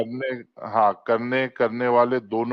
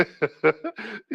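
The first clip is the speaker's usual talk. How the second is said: laughing; 8 semitones higher than usual. Real, not acted. Co-host laughing at the guest's curse, high giggle. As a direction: giggle helplessly.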